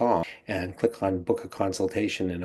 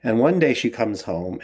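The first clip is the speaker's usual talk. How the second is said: normal talking voice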